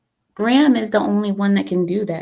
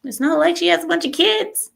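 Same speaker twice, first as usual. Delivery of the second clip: pitched way up, mocking; a catty aside pitched high; sassy high punchline; 10 semitones higher than usual. Real, not acted. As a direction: squeal it out with sass.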